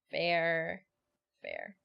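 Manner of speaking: says it through a laugh. Real, not acted. Laughing off a teasing reply. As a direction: chuckle the word out.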